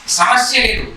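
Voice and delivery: normal talking voice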